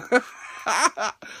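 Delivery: high peal of laughter. Real, not acted. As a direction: laugh helplessly at the callers. Laughing at the callers. (éclat de rire)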